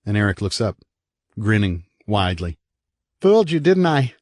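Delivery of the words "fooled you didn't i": put-on higher, teasing little boy voice; a cheeky kid pleased with his trick; impish boy. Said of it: "he says impishly".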